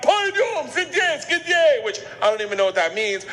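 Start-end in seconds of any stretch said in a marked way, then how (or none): 0.0-1.8 sharp barked French words. Retold or imitated. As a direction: bark it like a stern cop. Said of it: "I hear him bark out".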